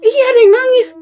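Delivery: high, loud and insistent; insistent cry. Retold or imitated. Insisting someone is crying. (bersikeras dengan suara tinggi dan keras)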